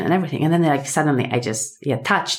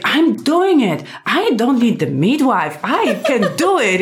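empowered cry; 7 semitones higher, 3 dB louder, slower than usual. Retold or imitated. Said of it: "I was just like".

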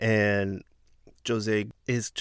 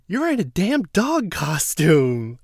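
higher, louder, slow jeering jab; slow mocking put-down; mocking Davon; 7 semitones higher, 5 dB louder, slower than usual. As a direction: sneer it like a taunt. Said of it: "So Davon goes".

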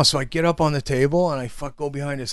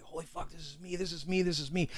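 very soft, slightly higher, repetitive murmur; retold hushed realization; 4 semitones higher, 16 dB quieter than usual. Reading the addiction book alone, hushed amazement. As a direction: breathe it to yourself as it dawns.

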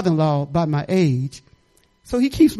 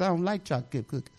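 ordinary delivery

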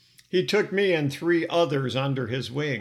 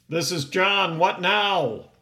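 short, irritated and loud, pitched up; curt, raised answer to an unwanted caller; 5 semitones higher, 5 dB louder, slower than usual. Retold or imitated. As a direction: snap it off like the phone won't stop ringing. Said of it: "answered with a curt".

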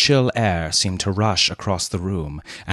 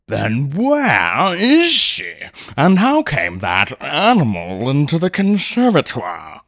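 very slow, louder demand; demanding colonel; 7 semitones higher, 4 dB louder, slower than usual. A narrator's reading. Colonel interrogating Leonard, stern demand. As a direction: demand answers, heavy and stern. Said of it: "demanded the colonel".

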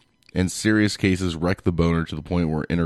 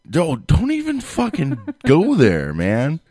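higher, louder playful protest; mock outrage; 9 semitones higher, 5 dB louder, slower than usual. Real, not acted. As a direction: protest loudly but laughing. Mock outrage at losing car.

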